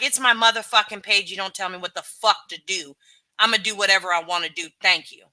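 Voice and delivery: a loud, swearing brush-off with a curt thank you; cursing version. Retold or imitated. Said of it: "my cursing version".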